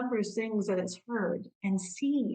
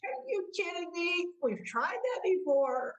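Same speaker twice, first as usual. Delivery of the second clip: higher, incredulous mock scoff; a higher, scoffing are you kidding me; doubting inner voice; 7 semitones higher than usual. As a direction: imitate the inner skeptic throwing cold water. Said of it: "and the voice that goes".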